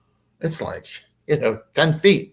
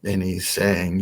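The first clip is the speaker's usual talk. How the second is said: ordinary talk, nothing marked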